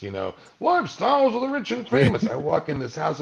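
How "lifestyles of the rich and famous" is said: much higher, a lightly hammed TV host announcing; Robin Leach. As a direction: toss it off like the old celebrity show host. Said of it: "Robin Leach houses".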